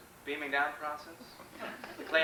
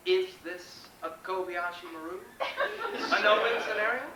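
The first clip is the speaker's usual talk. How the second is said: ordinary talk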